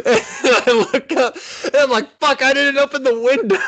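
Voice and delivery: high, loud laughing through the words. Real, not acted. Laughing as he tells friends the mishap. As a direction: crack up as you tell it.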